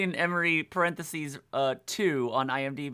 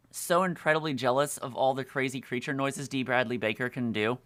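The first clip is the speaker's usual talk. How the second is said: ordinary delivery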